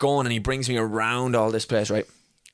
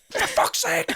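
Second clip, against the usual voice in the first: laughing exasperated hiss; slower than usual. Retold or imitated.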